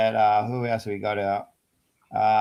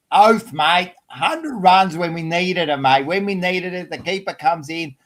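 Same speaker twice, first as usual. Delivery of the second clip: higher-pitched, fired-up sports fan; excited praise; 6 semitones higher, 4 dB louder than usual. Real, not acted. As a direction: rave about the player's big innings. Cheering a player's hundred runs.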